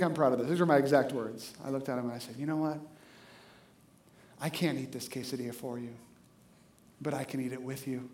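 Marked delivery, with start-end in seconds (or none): 2.4-8.1 low, hushed, solemn delivery; mock-heroic gravity, spoken softly; epic movie speech. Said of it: "This is our Independence Day" kind of speeches".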